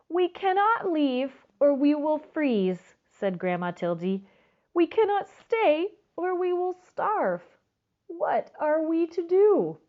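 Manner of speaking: high, fretful grandma voice; worried grandma. Acted. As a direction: sound trapped and worried, pitched high. Grandma's despair.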